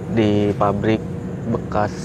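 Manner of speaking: ordinary delivery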